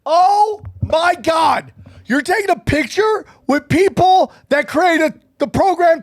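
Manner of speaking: high, indignant shout; appalled outburst. Real, not acted. Seeing the photo confirmed, appalled shouting. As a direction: cry out, scandalized.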